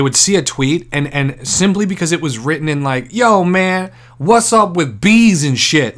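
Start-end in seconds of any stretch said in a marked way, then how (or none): 3.1-6.0 higher, louder mock street voice. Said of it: "written in verna-, in A A V E".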